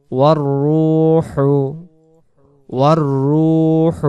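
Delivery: slow, melodic Quran recitation. Acted. Teacher recites Surah Qadr for the students, melodic. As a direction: recite each phrase slowly in tajweed.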